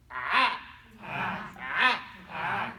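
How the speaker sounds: AH squeezed from the throat, the Arabic ayn sound; short, low AH made deep in the throat. Shown as a demonstration. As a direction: demonstrate the throaty ayn on each AH. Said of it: "one of those letters that you do not have".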